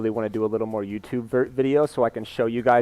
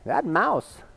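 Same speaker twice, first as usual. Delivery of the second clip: high, swooping celebrity impression of a father; impersonated dad urging his son on, pitch leaping; Christopher Walken; 6 semitones higher than usual. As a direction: tell a fable in halting, lilting bursts. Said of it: "he's talking to his son. He's like".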